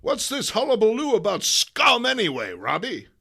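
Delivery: high, loud demanding editor; gruff boss. Acted. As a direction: bark the question. Publisher questions editor.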